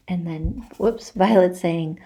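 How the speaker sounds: plain reading voice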